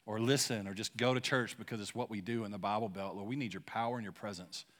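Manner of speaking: soft, low prayer voice, trailing off to near silence; quiet prayer. Acted. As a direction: pray it softly over the room. Leads the congregation in prayer.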